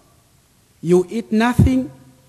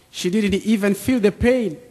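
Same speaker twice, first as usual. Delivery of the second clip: normal talking voice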